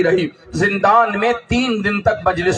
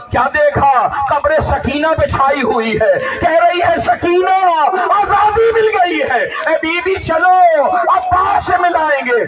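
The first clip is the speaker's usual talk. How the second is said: put-on wailing to the dead child; keening lament; 7 semitones higher than usual. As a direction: call out over the grave. Lament at Sakina's grave.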